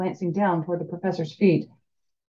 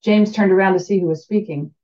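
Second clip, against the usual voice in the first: ordinary delivery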